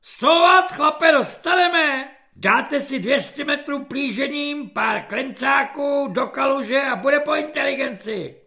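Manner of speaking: bullying corporal. Acted.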